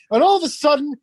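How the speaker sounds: ordinary talk, nothing marked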